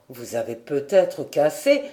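plain reading voice

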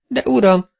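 high-pitched, taken-aback protest; squawked, flustered objection; flustered spluttering. A narrator's reading. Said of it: "hápogta Koprai bácsi".

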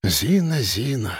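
soft, indulgent reproach; gentle weary disappointment; tutting. A narrator's reading. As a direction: sigh the name softly, falling away. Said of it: "цокнул главред".

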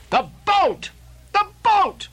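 high, urgent alarmed cry; Starbuck crying out. Acted. Starbuck warning Ahab about the boat, alarmed cry. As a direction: sound the alarm at the top of the voice. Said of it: "cried Starbuck".

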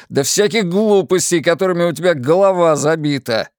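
husband yells contemptuously at his wife; shouting husband. A narrator's reading. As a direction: lash out at her, yelling. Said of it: "крикнул".

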